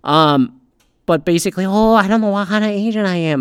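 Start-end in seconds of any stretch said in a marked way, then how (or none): 1.6-3.4 higher, put-on mocking voice; mock her with an exaggerated impression; hammed-up mimicry; mocking imitation. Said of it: "I'm really mocking it bad".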